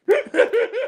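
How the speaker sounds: high shrieking laugh; squealing laugh. Real, not acted. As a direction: burst out in a squeal.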